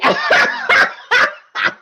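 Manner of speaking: very high, loud cackle; long uncontrolled burst of laughter; real belly laugh. Real, not acted. Laughs at own story. (carcajada aguda e incontenible)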